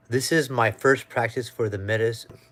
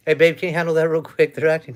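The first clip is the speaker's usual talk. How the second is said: calling out; 5 semitones higher, 8 dB louder, faster than usual. Real, not acted. Calling to partner off-camera.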